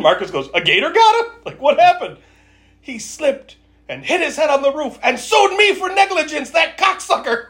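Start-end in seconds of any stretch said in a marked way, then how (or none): 0.5-2.2 eager surprise. Retold or imitated. Friend eagerly asks about the story. 2.8-7.5 high, hammed-up character voice; mock storyteller, higher pitched; dramatic storyteller punchline. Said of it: "it's all dramatic".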